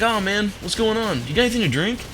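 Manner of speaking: put-on breezy teenage boy asking for a drink; kid voice. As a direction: pitch it way up like a carefree kid. Narrator voicing the neighbor kid.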